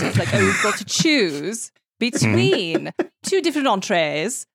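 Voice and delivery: hammed-up posh English storyteller; English accent. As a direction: hold a light British accent throughout. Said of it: "This whole time she's actually been English".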